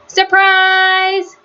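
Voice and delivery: high, drawn-out call of surprise; shouted surprise. A narrator's reading. Ladies springing a surprise on Miss Alma, high shout. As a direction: call it out high, stretching the word. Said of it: "all the ladies shouted".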